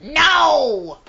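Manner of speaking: shrieking witch, loud and shrill; Wicked Witch voice. Retold or imitated. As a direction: screech it like the Wicked Witch of the West. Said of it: "I wanna say it like the witch in Wizard of Oz".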